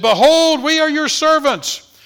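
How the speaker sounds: high, loud, drawn-out declaration; loud proclamation. Acted. Brothers fallen down before Joseph's face, loud proclamation. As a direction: proclaim it with full-voiced submission.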